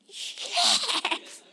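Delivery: high, hissed celebration; stifled high-pitched glee; secret triumph. Retold or imitated. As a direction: squeal a breathy, voiceless yes.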